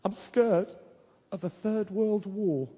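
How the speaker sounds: put-on slow, trembling little boy; a frightened five-year-old, whimpering; scared child voice. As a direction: drag it out like a tearful kid. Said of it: "he was crying his eyes out".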